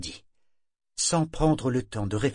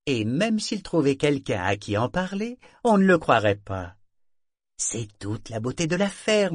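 smug, louder voice, mocking the madman; scornful schemer; 4 semitones higher, 4 dB louder than usual. Minxley reassures his partner, smug and scornful. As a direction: gloat about the perfect scheme.

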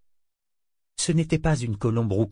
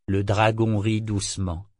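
ordinary delivery

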